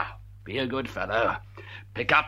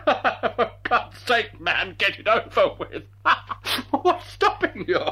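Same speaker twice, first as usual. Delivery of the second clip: high, slow laughing taunt; gleeful laughing; 8 semitones higher than usual. Acted. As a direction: taunt him through laughter. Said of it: "tears of triumphant glee".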